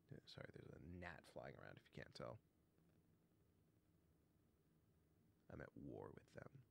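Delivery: hushed, fast aside; quiet aside. Real, not acted. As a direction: mumble it aside. Reader aside about gnat.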